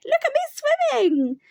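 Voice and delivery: high, loud little squeak; squeaky Roo. A narrator's reading. Little Roo shows off his swimming, proud squeak. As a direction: squeal it with pride like a tiny joey.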